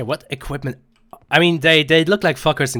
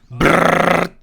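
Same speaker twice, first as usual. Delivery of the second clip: gunfire noise; 4 semitones higher, 5 dB louder than usual. Retold or imitated. Imitating gunfire.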